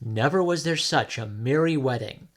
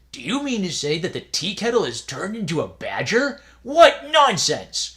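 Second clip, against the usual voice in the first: scoffing novice; 7 semitones higher, 6 dB louder than usual. Acted. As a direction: scoff at such nonsense.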